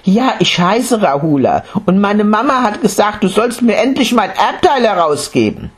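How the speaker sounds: put-on high child's voice reciting; a boy repeating what mama said. Boy telling the monk his mother's message.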